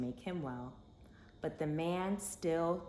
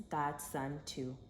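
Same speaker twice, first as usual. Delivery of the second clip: ordinary delivery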